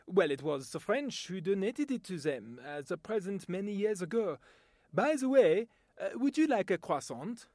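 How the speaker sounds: English spoken with a French accent. Shown as a demonstration. A Frenchman offering a croissant, in a French accent. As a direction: play a Frenchman boasting about France's gifts. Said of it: "my voice talent demonstration".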